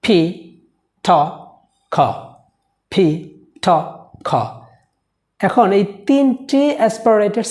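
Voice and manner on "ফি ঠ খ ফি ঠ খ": ph, th, kh said with a puff of air; aspirated demo; aspirated sounds. Shown as a demonstration. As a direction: breathe out hard on each. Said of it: "And this is".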